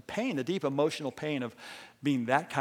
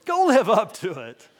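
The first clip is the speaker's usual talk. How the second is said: laughing; 7 semitones higher, 9 dB louder than usual. Pretend. Playful reenactment with laughter.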